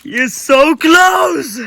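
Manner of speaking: high, loud, slow cheer; excited shout. Real, not acted. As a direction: cheer him on loudly.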